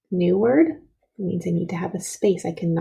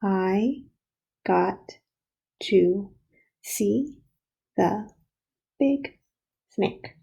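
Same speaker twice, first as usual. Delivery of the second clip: one word at a time, a pause after each; counting out words; much slower than usual. Shown as a demonstration. Teacher helps pupils count out the words, one by one. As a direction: separate every word as if counting. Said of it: "let's count out the words".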